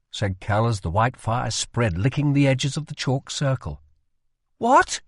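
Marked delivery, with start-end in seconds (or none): 4.6-5.0 challenging. Acted. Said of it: "challenged Rye".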